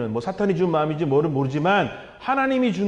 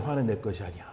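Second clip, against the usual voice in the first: much softer and lower echo of 화는 내 것이 아니야; soft repeat; 10 semitones lower, 8 dB quieter than usual. Shown as a demonstration. Preacher echoes his point to the congregation, softly. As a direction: let 화는 내 것이 아니야 sink in quietly. Repeats the key sentence twice.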